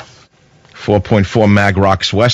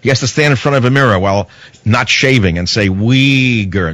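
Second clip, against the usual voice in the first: ordinary talk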